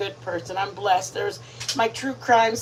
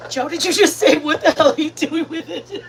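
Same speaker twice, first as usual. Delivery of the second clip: high, amused incredulous question; laughing while calling him out; laughing disbelief; 5 semitones higher, 4 dB louder than usual. Real, not acted. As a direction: repeat it back through laughter.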